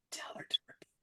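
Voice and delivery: hushed unvoiced whisper; whispered aside. Frosty prompting from the side, whispered. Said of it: "I even heard Frosty go".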